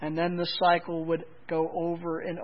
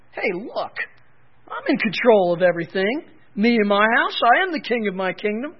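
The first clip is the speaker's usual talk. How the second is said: mock proud ruler; 3 semitones higher, 8 dB louder than usual. Mocking the proud self-ruler.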